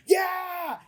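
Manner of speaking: high shouted yeah; excited yell. Retold or imitated. DJ answering the crowd, high excited shout.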